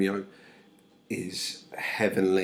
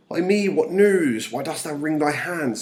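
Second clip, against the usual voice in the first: dramatic lament; 7 semitones higher, 7 dB louder than usual. Acted. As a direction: declaim Juliet's alarmed questions. Acts Juliet's anxious questioning of nurse.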